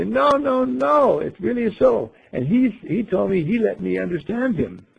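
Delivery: pleading to be believed, pitch way up; insistent. Acted. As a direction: protest earnestly in an acted-out high voice. Voicing swimmer insisting it's true.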